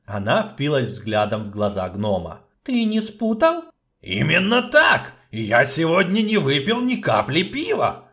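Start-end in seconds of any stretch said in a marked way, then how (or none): 2.6-3.6 doubtful question. Acted. Sorceress doubting. 4.0-8.1 clipped dwarf. Acted. Dwarf insisting to the sorceress, each word hammered out. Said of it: "отчеканил гном".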